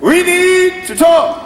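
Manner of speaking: high, loud, drawn-out demand; angry mother. Acted. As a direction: impersonate a cross mom laying down the law. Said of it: "my mother was very angry with me".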